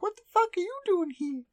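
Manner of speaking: very high squeaky character voice; exaggerated falsetto impression; high-pitched Delta. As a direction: put on a silly, sky-high anime girl voice. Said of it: "such a high-pitched voice".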